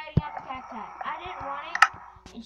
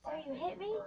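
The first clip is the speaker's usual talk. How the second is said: ordinary delivery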